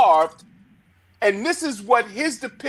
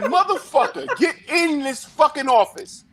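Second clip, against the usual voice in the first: retold angry boss; 6 semitones higher than usual. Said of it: "and said".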